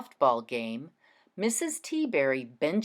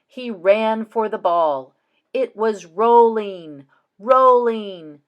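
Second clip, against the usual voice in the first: louder, slower, building excitement on "rolling, rolling"; excited louder read; 3 semitones higher, 8 dB louder, slower than usual. Shown as a demonstration. Teacher reading the chase to the class, louder and excited. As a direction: lift the volume and stretch "rolling". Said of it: "mean to say the word slower and ... a bit louder".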